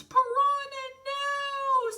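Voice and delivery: very high, loud character wail; alarmed cry. A narrator's reading. Wolf cries out as his friend jumps, alarmed. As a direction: cry out high, stretching the last word.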